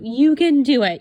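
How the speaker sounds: loud, high pep shout; cheering. Retold or imitated. Reading her planner pep note aloud as a loud cheer. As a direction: cheer yourself on. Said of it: "a little nice positive note".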